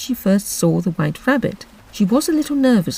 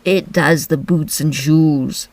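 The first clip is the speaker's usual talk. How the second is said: slow, solemn Griffin; grave and drawn out; slower than usual. Acted. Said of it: "replied very solemnly".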